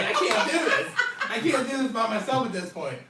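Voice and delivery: high-pitched comic pleading; hammed-up helpless protest; mock complaint. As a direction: plead in a high, half-laughing voice. Acting out his overwhelmed complaint.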